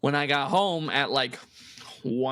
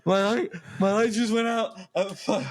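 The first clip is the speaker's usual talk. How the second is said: higher, breathless mock panic; panicky self quote; 5 semitones higher than usual. Teen explaining the dead light to dad, pitched-up mock panic. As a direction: relive the fright, pitched up. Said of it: "I said".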